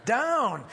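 "down" high and falling; emphasis on "down". Shown as a demonstration. Preacher has listeners say it, emphatic "down". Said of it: "I'll emphasize the key words".

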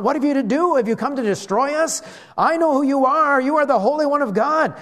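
high, forceful retold outcry; demon crying out. Preacher voices the demon recognising Jesus, crying out. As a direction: cry it out, pitched well up. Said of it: "So he's crying out".

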